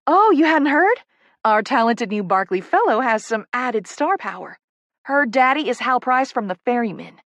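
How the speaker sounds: high, loud opening, eager reveal; excited Southern publicist. Acted. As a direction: break the big news with Southern relish. Poppy reveals the celebrity father.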